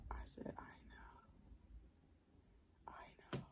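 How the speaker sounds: hushed unvoiced whisper to a friend nearby; low whisper aside to the friend; real whisper. Real, not acted. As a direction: keep it down so he can't hear. Whispering so caller won't hear.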